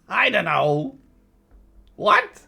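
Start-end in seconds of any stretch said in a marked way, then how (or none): 2.0-2.4 high startled what; surprised. Real, not acted. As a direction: blurt it out in surprise. Surprised confused exclamation.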